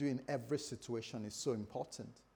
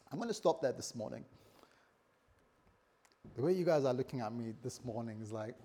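normal talking voice

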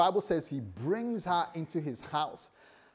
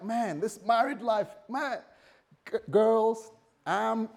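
higher mock-girlish voice, gushing; boasting bride; 8 semitones higher, 3 dB louder, slower than usual. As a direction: brag to the girls in a high, giddy voice.

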